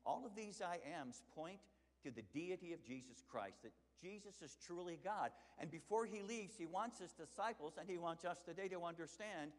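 loud, high impassioned preaching. Acted. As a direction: preach it out with full fire. Preaching at full force.

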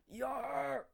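high short battle cry; battle yell. A narrator's reading. A boy in the arena yelling as he attacks, high battle cry. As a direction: bellow as you swing. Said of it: "they heard from below".

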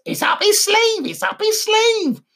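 high parrot voice. Acted. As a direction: squawk it like a talking parrot.

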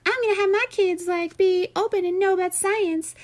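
high-pitched mocking caricature; mocked atheist parent. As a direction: put on a high, silly know-it-all parent voice. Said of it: "one of those people that".

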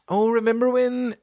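high-pitched, hammed-up girly gushing; high mock reminiscing; mock nostalgic girl. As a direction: imitate a girl sighing over old times, way up high. Mocking girls reminiscing about the past.

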